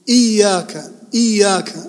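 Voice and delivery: إياك as iy-yaka, firm and held on the Y; doubled ya. Shown as a demonstration. Teacher asking students about shadda, stressed Y in إياك. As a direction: press hard on the double Y in إياك. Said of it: "الياء مشددة".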